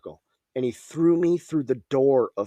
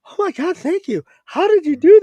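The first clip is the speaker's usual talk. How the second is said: high, squeaky gushing gratitude; surprised; 8 dB louder than usual. Retold or imitated. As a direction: squeal it like a startled stranger. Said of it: "they're like".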